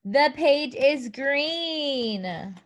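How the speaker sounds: slow, clear picture-book voice; sing-song prompts for little kids; reading to a toddler. Acted. Teacher reading to young children.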